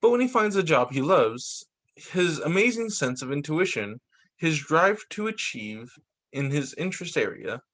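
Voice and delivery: even, measured reading of a picture-book script; storybook narration. A narrator's reading. Narrator reading the dog ADHD story to viewers, storybook style. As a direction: read it like a gentle explainer book.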